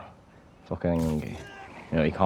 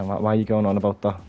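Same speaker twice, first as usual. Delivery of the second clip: ordinary talk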